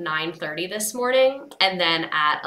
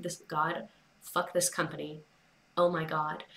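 much softer, muttering under her breath; muttered frustration; 3 semitones lower, 10 dB quieter than usual. Retold or imitated. Retelling her exasperated reaction to the rental company, a soft mutter. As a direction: grumble it to yourself, fed up. Retold fed-up reaction on the phone.

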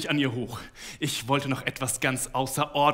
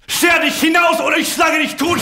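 much higher, much louder threatening yell; furious shout; 12 dB louder than usual. A narrator's reading. Husband threatening his wife to get out, furious shout. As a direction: roar the threat at her. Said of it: "schrie ich".

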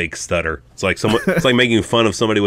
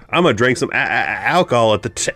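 higher, mock-stuttering kid; 3 semitones higher than usual. Imitating the pirate kid for co-host, mock stutter. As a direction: stutter into it, then drag out the last word. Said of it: "sound like the pirate kid".

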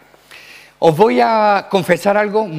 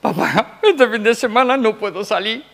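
higher, mock-tearful daughter whining to dad; hammed-up almost-crying plea; nearly crying; 5 semitones higher than usual. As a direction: imitate a daughter on the verge of tears. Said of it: "casi llorando".

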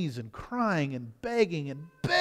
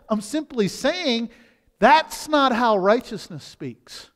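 forceful preaching; 3 semitones higher, 5 dB louder than usual. Acted. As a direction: drive the point home from the pulpit.

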